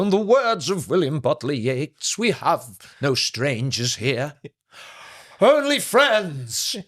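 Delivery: theatrical thespian quoting poetry; grand old actor. Retold or imitated. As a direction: declaim Yeats to a hushed pub. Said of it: "And he'd go".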